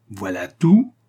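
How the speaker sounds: loud, incredulous rising question; exasperated exclamation. A narrator's reading. Astronomer exasperated by the lieutenant's calm, incredulous exclamation. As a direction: echo it back in outrage, swooping up. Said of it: "s'écria l'astronome".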